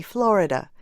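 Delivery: ordinary talk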